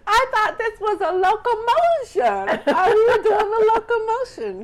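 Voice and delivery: high, loud, laughing through the words. Real, not acted. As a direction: laugh while teasing. Laughing at coworkers following.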